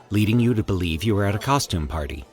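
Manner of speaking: ordinary delivery, nothing marked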